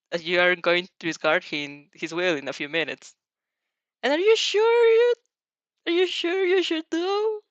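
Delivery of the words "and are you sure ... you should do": much higher, slower questioning inner voice; a nagging inner voice, pitched high and slow; voice of logic. Acted. Inner voice of logic doubting her, high and slow. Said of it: "the voice of logic asks".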